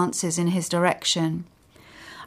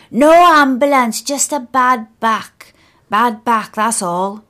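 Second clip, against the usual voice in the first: much louder, higher shout fading toward the end; old man shouting; 4 semitones higher, 8 dB louder than usual. Acted. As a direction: shout it across the road, then settle. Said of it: "I can hear him shouting".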